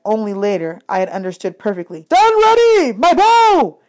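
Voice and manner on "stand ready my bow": much higher and louder battle command; commanding shout. Acted. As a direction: bark orders to the camp. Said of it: "Chiron shouted".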